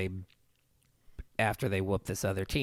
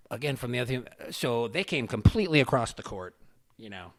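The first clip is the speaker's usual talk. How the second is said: usual speaking voice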